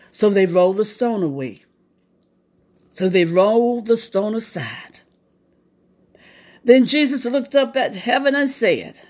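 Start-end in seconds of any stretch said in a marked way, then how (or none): none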